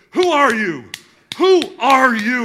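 high, loud, urgent shout. Retold or imitated. Masseur yelling at the player on the table, loud high shout. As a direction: demand it at full volume, fists flying. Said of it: "starts punching me in the chest".

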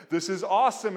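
giddy divine cheer; excited. Acted. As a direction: cheer it like a high five. Godhead high-fiving.

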